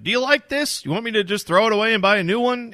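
high, fast eager fan-pleaser voice; high, rushed mock owner; imitating Steve Cohen. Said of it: "He's on Twitter every day asking fans".

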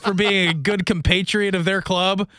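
laughing through a sarcastic dig; teasing laugh on the words; laughing sarcasm. Real, not acted. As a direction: chuckle while needling.

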